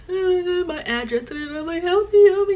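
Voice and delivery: high-pitched mock sobbing, swelling to a loud plea; hammed-up tearful begging; imitated crying. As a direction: wail for help as if in tears.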